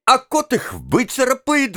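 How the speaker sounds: high-pitched heated retort; exclaiming. A narrator's reading. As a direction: snap back high and quick to provoke. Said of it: "воскликнула Вероника". (задорный возглас)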